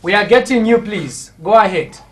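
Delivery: loud urging down the line; calling out. Real, not acted. Host urging a silent caller to go ahead, calling out. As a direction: project to a caller who can't hear.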